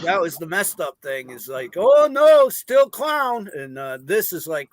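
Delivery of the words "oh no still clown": put-on high, loud frightened cry of a child; mock scared kid. Retelling how kids reacted to his clown costume, high mock fright.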